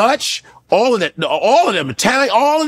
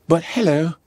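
put-on British accent.

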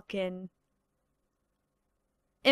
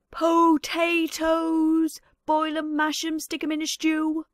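high, slow, hissing creature voice; Gollum impression; 4 semitones higher than usual. Creature scoffing at potatoes, high hissing drawl. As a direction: gloat over the potatoes like a cave creature. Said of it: "some of these impressions".

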